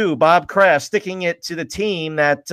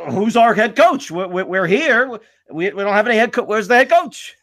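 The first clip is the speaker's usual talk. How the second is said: mock bewilderment; 6 semitones higher than usual. Voicing staff left in limbo.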